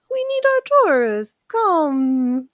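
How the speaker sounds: high, sing-song mock welcome; mock eager official. Mocking officials luring tourists in, high and eager. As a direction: play an overeager tourism booster.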